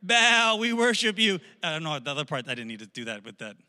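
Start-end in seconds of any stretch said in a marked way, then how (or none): 0.0-1.4 high, loud worshipper's cry; mock fervent shouting; acted shouted plea. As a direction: call up to your god for a sign. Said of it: "they're shouting".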